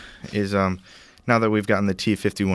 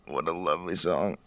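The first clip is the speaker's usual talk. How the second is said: soft, lowered put-on voice; put-on low echo; 3 semitones lower, 7 dB quieter than usual. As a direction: echo the praise quietly, down low. Echoes own praise in lower voice.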